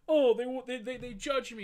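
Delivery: high, loud whiny mimicry of her complaining; mocking whine. Mocking the runaway girl's complaint in a high, loud whine. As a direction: squeal it out like a sulking teen. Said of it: "so upset with".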